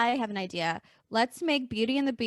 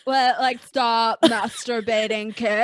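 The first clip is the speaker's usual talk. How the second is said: slow puzzled executive; 6 dB louder, slower than usual. Retold or imitated. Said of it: "And Kellogg is like".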